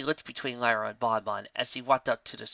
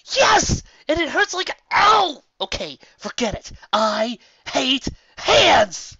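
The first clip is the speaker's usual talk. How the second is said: high shouting with a cry; loud pained outburst; 13 semitones higher, 10 dB louder, slower than usual. Acted. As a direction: rage at the tiny wound. Lyra angry about paper cut.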